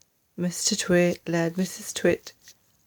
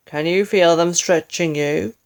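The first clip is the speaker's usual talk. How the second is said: louder sly question; sly husband; 6 dB louder than usual. Acted. Husband checks on stretched wife, sly and louder. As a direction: ask it with mock care. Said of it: "asked Mister Twit".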